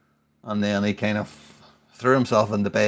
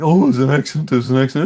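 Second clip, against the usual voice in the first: mimicking the son; 5 semitones higher, 8 dB louder than usual. A dad mimicking his son's excuse a second time. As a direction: whine it like a protesting little boy. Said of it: "he was all like".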